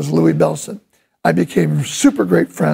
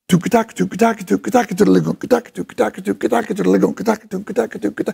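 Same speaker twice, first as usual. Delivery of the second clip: drum syllables; faster than usual. Shown as a demonstration. Drummer sings the seven-beat pattern to students, fading. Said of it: "Playing in seven".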